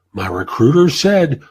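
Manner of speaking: ordinary talk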